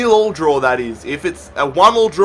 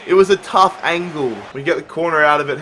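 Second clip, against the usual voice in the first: usual speaking voice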